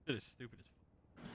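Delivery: unvoiced, slow mutter; whispered. Real, not acted. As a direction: say it under your breath. Muttered comment.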